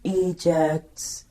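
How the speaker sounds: robotic slow command; Soundwave command. Retold or imitated. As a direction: announce it like a machine. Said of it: "and he said".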